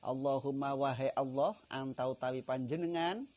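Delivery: loud devout chanting of the supplication; preaching at full force. Acted. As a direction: proclaim the prayer with fervour.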